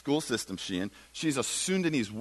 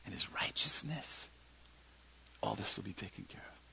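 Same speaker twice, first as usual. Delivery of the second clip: hushed, almost breathless ending; whisper; 4 semitones lower, 15 dB quieter than usual. Acted. Preacher as Jesus, whispering the promise. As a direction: let it fall to a whisper.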